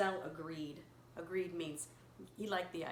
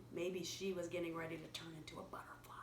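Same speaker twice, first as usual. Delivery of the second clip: whisper; 4 semitones lower, 7 dB quieter than usual. Real, not acted. Secretive wondering aside.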